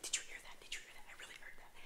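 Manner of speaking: frightened unvoiced whisper; scared whisper. Kids whispering in fear in the bathroom. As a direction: hiss it in a hushed panic. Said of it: "They were like".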